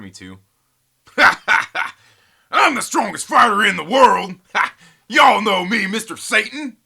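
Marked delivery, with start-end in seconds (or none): 1.1-1.9 huge, high, loud showman laugh; booming Hercule laugh. Pretend. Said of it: "Hercule voice if you wanted to". 2.5-4.4 booming, high, loud wrestler boast; Mister Satan voice. Pretend. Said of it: "Hercule voice if you wanted to". 4.4-5.0 unvoiced cocky chuckle; breathy showman laugh. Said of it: "Hercule voice if you wanted to". 5.1-6.8 booming, high, loud wrestler boast; Mister Satan voice. Pretend. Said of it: "Hercule voice if you wanted to".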